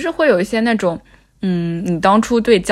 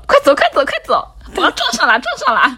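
high, frantic laughing cry; panicked shout; 6 semitones higher than usual. Retold or imitated. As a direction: shriek it out in a panic. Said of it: "说".

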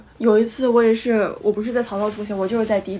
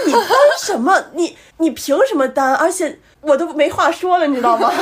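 high, loud, laughing indignation; indignant retort; 6 semitones higher, 4 dB louder than usual. Retold or imitated. Retelling her furious reply to the driver, high and laughing. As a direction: fire back in disbelief, half laughing.

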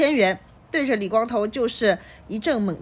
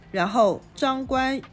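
usual speaking voice

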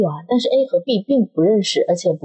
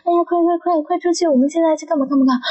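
eager friend; 9 semitones higher than usual. Retold or imitated. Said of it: "他说".

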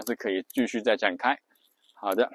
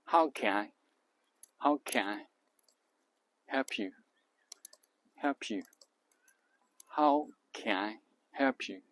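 "How can I" and "help you" as joined chunks, softly, with gaps between; soft, slow chunked "How can I help you"; linked stress groups; 6 dB quieter, slower than usual. Shown as a demonstration. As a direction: run "can I" together, then "help you" as one beat. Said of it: "can和I又并在一起".

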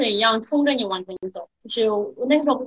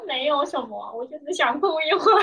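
retelling a tearful line through real laughter; laughing while saying it; 3 semitones higher than usual. Real, not acted. Young woman retelling her answer to a worried patrolman, laughing. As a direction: let the words break up into a laugh at the end. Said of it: "当然不是这样笑着说".

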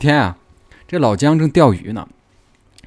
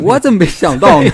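loud, higher-pitched mock realization; sudden realization; 6 semitones higher, 7 dB louder than usual. Storyteller acts Jiang struck by the fortune-telling idea, mock amazed. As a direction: exclaim as if it just struck you.

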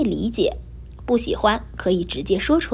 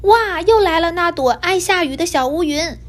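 high, louder alarmed shout in a put-on kitten voice; startled cry; 7 semitones higher, 3 dB louder than usual. A narrator's reading. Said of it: "白猫惊叫了起来".

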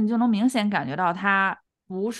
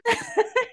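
short high giggle; high laugh. Real, not acted. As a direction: burst into a giggle. Laughing at memory.

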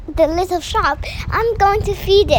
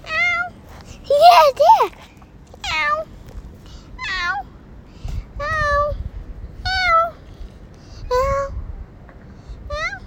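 high meowing calls; cat meow; 10 semitones higher, slower than usual. Acted. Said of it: "Use cat language".

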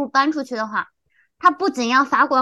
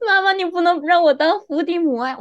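whiny protest; 4 semitones higher than usual. Retold or imitated. Whining back at mom.

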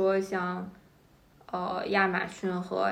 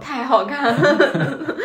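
sarcastic; 6 semitones higher, 9 dB louder, much slower than usual. Real, not acted. Ironic echo with laugh.

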